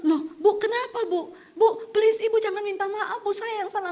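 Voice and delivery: high, flustered protest, half laughing; a hint of baffled man on the phone; confused surprise. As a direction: act the confused pastor insisting the fault is his. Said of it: "Bapaknya malah bingung".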